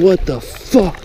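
ordinary delivery

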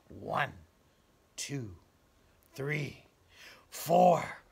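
counting up toward the thunderclap; rising count. A narrator's reading.

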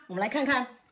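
ordinary talk, nothing marked

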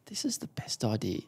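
excited whisper to Mum; hushed and thrilled, sharing a secret. Retold or imitated. Excited boast to Mum beside doctor.

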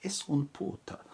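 hammed-up Spanish accent; put-on Spanish accent. Shown as a demonstration. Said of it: "Why am I doing this damn accent".

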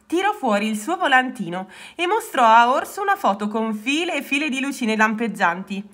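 ordinary delivery

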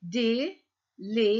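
"delicious" very slowly as de, li, cious; three beats in "delicious" with gaps between; split into syllables. Shown as a demonstration. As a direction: sound out "delicious" one syllable at a time. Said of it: "ثلاث مقاطع".

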